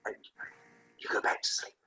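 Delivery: soft, breath-only whisper; acted bedtime hush; hushed retreat. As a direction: whisper it, backing off to let them sleep. Acting whispering to sleeping partner.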